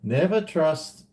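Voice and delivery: normal talking voice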